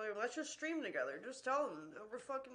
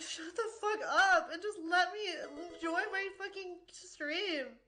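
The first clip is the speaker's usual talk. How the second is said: crying outburst; 6 semitones higher than usual. Retold or imitated. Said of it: "I'm like".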